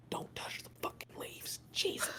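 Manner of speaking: hushed, annoyed whisper; whispered warning. Acted. Bitten Holb whispers a warning to the party. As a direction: hiss a warning under your breath.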